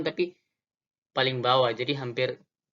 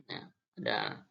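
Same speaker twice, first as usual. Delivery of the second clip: raspy demo; 11 dB quieter than usual. Shown as a demonstration. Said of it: "serak-seraknya seperti itu".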